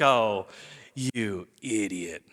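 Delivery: low, slow, pitying put-down; scornful. Acted. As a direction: dismiss him as a fool, dropping low. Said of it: "look at Jesus like he's a fool".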